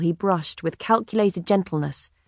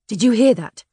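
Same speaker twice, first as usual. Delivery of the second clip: louder, higher, rattled question; shaken; 5 semitones higher, 5 dB louder than usual. A narrator's reading. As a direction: ask it with a jolt of alarm. Tom shaken, asks Elizabeth.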